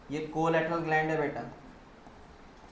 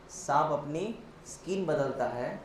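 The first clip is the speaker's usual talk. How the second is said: drawn-out phrases, pause and lift after अपनी; slow emphatic repeat. Shown as a demonstration. Teacher driving home the snake comparison, slow and segmented. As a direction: say it slowly, piece by piece, letting skin stand out.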